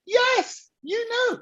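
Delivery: very high, thrilled retold reply; high-pitched triumphant confirmation; delighted yes. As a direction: squeal that they got it. Retells delight at right answer.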